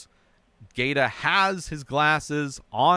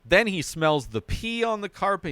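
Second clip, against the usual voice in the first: ordinary talk, nothing marked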